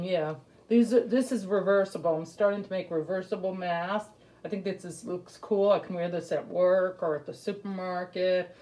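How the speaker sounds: muffled behind fabric mask; speaking with mouth covered; through a cloth mask. Real, not acted. Seen 1: Homemade cloth mask covers mouth and nose while she speaks.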